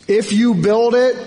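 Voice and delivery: mysterious movie voice. Acted. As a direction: raise it as a voice calling. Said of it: "he hears these words".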